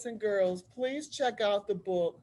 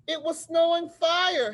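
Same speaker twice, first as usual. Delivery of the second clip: higher and louder, full of wonder; awed exclaim; 8 semitones higher, 7 dB louder than usual. Acted. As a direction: tell it like you saw it. Harriet describes the meteor shower.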